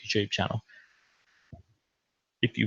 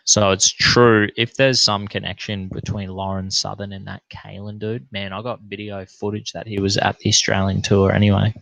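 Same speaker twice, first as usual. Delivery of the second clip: ordinary talk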